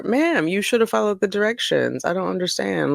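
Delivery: ordinary delivery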